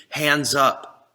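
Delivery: ordinary talk, nothing marked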